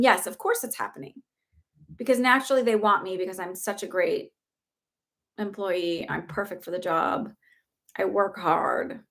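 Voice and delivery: usual speaking voice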